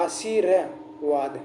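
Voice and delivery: आशीर्वाद slowly, as आ-शी-र-वाद; demonstrated pronunciation. Shown as a demonstration. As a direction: sound out आशीर्वाद with the र set apart. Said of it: "अब इसका उच्चारण कर देखिए".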